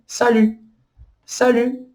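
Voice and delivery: salut slowly with the stress on -lut; final-syllable stress. Shown as a demonstration. Teacher slowing salut for students, stress on last syllable. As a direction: draw out salut onto its end. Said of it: "a última sílaba é a sílaba tônica".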